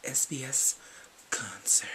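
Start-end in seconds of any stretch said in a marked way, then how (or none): 1.3-1.9 breathy hushed whisper of the word. Acted. Vlogger whispering the word concert. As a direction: whisper it like a secret.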